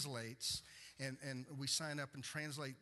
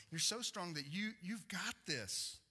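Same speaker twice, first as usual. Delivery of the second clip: preaching at full force; 7 semitones higher than usual. Acted. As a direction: pour it out to God, building.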